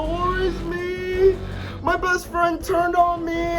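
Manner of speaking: high, slow self-pitying whine; mock him as a crybaby. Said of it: "and you wanna go".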